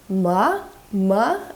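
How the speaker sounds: ma rising as if asking a question. Shown as a demonstration. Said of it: "like you're asking a question".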